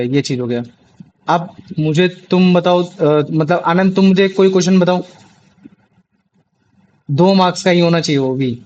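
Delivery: ordinary talk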